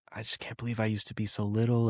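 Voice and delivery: soft, quick imitation of choked-up daughter; small, hushed, mildly tearful quote. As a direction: imitate a child getting emotional, softly. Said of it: "like, choked up".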